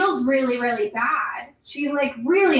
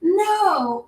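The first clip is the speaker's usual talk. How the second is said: high, mildly put-out "No"; annoyed no; 6 semitones higher than usual. Retold or imitated. Finn reacting as Chewie explodes, mildly annoyed high "No". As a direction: say "No" like a coach whose team lost the ball. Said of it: "not, like, the right kind of no".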